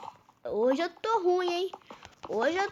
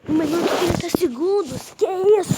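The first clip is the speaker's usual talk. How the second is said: loud exclaim; 3 semitones higher, 8 dB louder, faster than usual. Real, not acted. Countdown while waiting, exclaiming.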